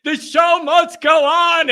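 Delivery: drawn-out, high, loud mock yell; hammed-up, high-pitched yell; yelling show-must-go-on cry. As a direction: holler it slowly like a diehard at ninety-eight. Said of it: "picture me here at ninety-eight years old yelling".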